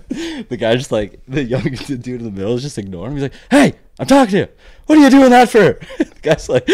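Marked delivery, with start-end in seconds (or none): 3.5-5.8 loud, high mock yell of an old East Coast fella; angry old man. Telling off a guy who cut past him, loud high mock yell. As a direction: belt it out like an indignant old passenger.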